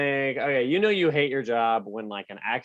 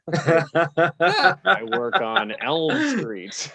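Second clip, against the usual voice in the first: laughing mock line; 3 semitones higher, 3 dB louder, much slower than usual.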